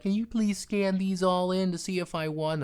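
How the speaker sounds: a hint of a little old lady asking a favour. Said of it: "little old lady comes up".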